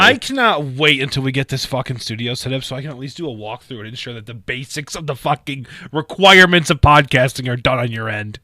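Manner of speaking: loud, emphatic dressing-down, easing at the end; real fed-up scolding; exasperated rant. Real, not acted. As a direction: tear into a co-host over his blunder. Ranting at co-host's setup failure.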